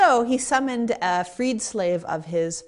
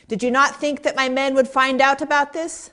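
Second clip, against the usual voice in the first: higher-pitched retold accusation; accusing challenge; 6 semitones higher, 4 dB louder than usual. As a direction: confront him, pitch lifted to the end. Acted accusation of the suspected host.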